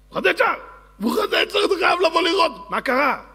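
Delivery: high, loud shouts for the king; acted frantic calling. Onlookers call the king to see.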